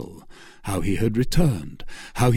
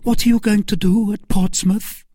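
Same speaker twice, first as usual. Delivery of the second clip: high, louder Milady voice; questioning Milady; 11 semitones higher, 5 dB louder than usual. Acted. Milady questioning Felton on his plans, high and pressing. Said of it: "asked Milady".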